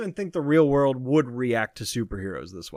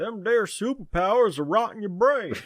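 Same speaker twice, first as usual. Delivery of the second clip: higher Texan dad scolding his son; mock Southern father scolding, raised; 7 semitones higher than usual. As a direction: lecture the boy like a Texas dad. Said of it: "good old Mike Judge".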